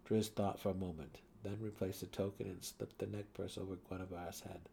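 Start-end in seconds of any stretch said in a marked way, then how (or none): none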